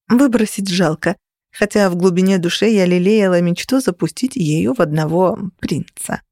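ordinary delivery, nothing marked

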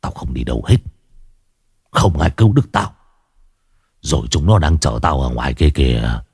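put-on low, menacing old man's voice; a possessed father threatening his family, low and menacing; menacing haunted old man. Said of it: "ánh mắt của ông vằn lên tia dữ tợn".

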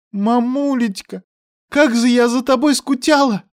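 put-on high, bright-eyed child voice; little girl happily greeting mom; joyful little girl. A narrator's reading. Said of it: "радостно сказала малышка".

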